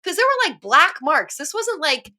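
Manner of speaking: getting worked up, pitch climbing high; real upset rising into a high, strained plea; emotional, getting choked up. Real, not acted. Speaker moved about hit-and-run drivers, emotional and high-pitched. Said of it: "this one gets emotional".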